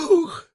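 sharp, high startled "ooh"; frightened gasp. Acted. Woman startled by a voice nearby, frightened high gasp. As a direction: gasp loudly in sudden fright. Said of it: "испуганно вздыхает женщина".

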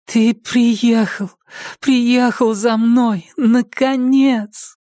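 high, slow, otherworldly whisper of Patricia; Patricia's whisper. Acted. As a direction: breathe it out high and slow as the ghostly girl. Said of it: "Шёпот Патриции".

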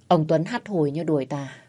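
ordinary delivery, nothing marked

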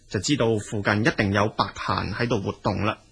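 usual speaking voice

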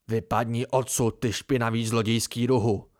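a spiteful, contemptuous hiss; hateful snarl at the thief; spat hatefully. Acted. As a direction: spit the insult with venom. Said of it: "vyplivl záštiplně".

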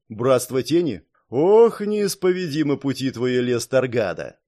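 plain reading voice

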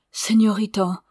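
appel plus haut et plus fort, ému; s'écrier. A narrator's reading. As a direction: crie son nom, bouleversé de la voir pleurer. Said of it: "s'écria-t-il". (s'écrie plus fort et plus haut, ému)

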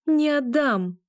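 high, emphatic refusal; fierce narrated defiance; defiant, growing stronger. A narrator's reading. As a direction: stand your ground with sudden force. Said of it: "стал глубже и громче".

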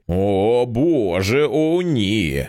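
drawn-out wail, held back in volume; narrated hysterical cry, understated; anguished cry. A narrator's reading. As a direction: moan it out in stretched, grieving vowels. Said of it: "кричала Сюзі".

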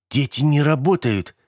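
high-pitched, cross little protest; mildly angry. A narrator's reading. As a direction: object with a touch of anger. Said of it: "рассердилась Габи".

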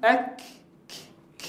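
light short ك; kaf demo. Shown as a demonstration. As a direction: say أك thin and quick. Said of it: "انظر كيف يتجه الصوت".

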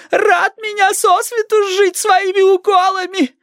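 put-on piercingly high, slow old-woman voice; high granny whine; querulous grandma. A narrator's reading. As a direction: complain in a drawn-out, very high granny voice.

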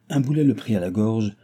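usual speaking voice

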